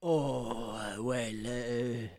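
low, soft, hesitant French shopkeeper; reluctant. Acted. Cheese seller asked if he is busy, reluctant. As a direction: trail off awkwardly, low and soft. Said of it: "he sort of gestures around".

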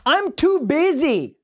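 high-pitched put-on excuse voice; mock whiny objection. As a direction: play the hammed-up reluctant customer. Plays objecting customer in ad.

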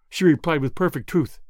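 ordinary delivery, nothing marked

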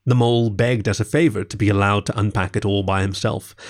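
usual speaking voice

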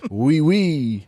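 mock French. Chiming in on the French money joke, mock French. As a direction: toss in a playful mock-French voice.

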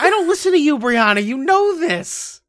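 high, loud comic outrage; bickering retort. Real, not acted. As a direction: fire back at your co-host in exasperation. Bickering back at co-host.

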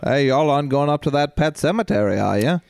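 put-on lightly drawled Maine accent; hint of a Maine local warning. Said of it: "that famous line?".

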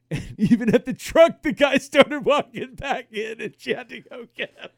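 high, loud, breaking into laughter; real cracking up mid-sentence; laughing while talking. Real, not acted. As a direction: barely get the story out for laughing. Laughing hard while telling the story.

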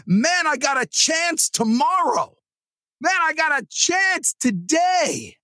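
slow, high, shouted pep; excited wake-up thought. Pretend. Telling listeners how to wake up, excited shout. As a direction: fire yourself up for the day.

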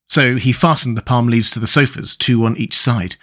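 ordinary delivery, nothing marked

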